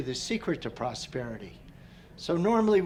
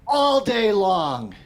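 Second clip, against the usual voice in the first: loud, higher and slow, each word stretched; imitated loud command; 6 semitones higher, 9 dB louder, much slower than usual. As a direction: declare it slowly like a stern teacher. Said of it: "And he goes".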